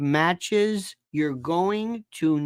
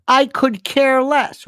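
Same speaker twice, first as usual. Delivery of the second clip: "I could care less" slower, louder and higher, mocking it; mocking misused phrase; 7 semitones higher, 7 dB louder than usual. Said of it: "Like the word".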